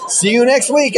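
showman punchline. Retold or imitated. Magician to audience as the cards match, high showy payoff. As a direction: toss out the reveal high and showy. Same punchline acted in retold act.

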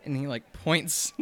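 ordinary delivery

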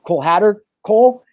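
imitating a high, loud incredulous question; retelling his own shocked double take; surprised disbelief. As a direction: jump up in pitch as if he can't believe it. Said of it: "And we're like".

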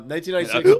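giggling while getting the words out; laughing through hiccups. Real, not acted. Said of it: "This is pissing me off".

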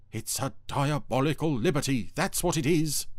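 high, loud outraged declaration; indignant Dougal. A narrator's reading.

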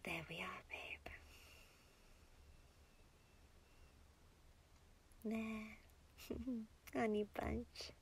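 very soft, whispery endearments; tender hush. Acted. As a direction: soothe a loved one in a near whisper. Holding and soothing partner quietly.

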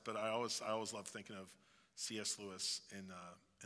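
ordinary talk, nothing marked

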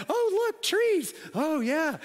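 high, breathy-free giddy sightseeing voice; giddy girls. Retold or imitated. Pastor mimics his wife and daughters gushing on the canoe, high and giddy. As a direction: mimic dreamy girls pointing at trees.

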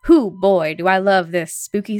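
usual speaking voice

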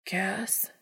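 faint unvoiced call, rising; weak whisper. A narrator's reading. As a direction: barely get the name out. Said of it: "a weak voice asks".

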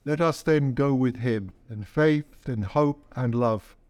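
ordinary talk, nothing marked

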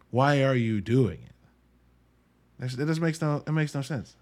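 ordinary talk, nothing marked

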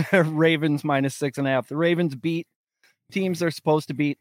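normal talking voice

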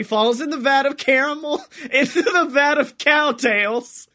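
words broken up by real laughter; can't hold the laugh in, keeps talking; laughing through words. Real, not acted. Laughing as he pictures the villain falling in the vat. Laughing while telling the story.

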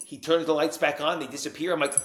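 ordinary delivery

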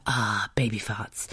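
soft breathy whisper, slowed right down; cooing whisper. Retold or imitated. Mum doting over her baby's little farts, in a soft whisper. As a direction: whisper it like you're doting on a sleeping baby.

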